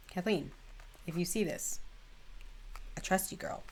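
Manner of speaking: hushed, confiding, trailing into a whisper; soft aside to Kathleen. Real, not acted. Vlogger confides softly to Kathleen Lights, hushed. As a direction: drop to a near whisper, just for her.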